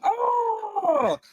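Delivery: high, far, crying "oh"; mock wail. Imitating everyone's teary movie cry, a high wail. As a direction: wail like a sobbing crowd. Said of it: "everybody goes".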